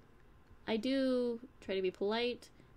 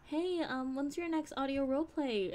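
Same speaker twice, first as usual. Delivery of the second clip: higher, lightly voiced fan asking; eager fan; 6 semitones higher than usual. Fans asking her about new roleplay, lightly mimicked higher voice. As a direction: lift the pitch for a fan's question.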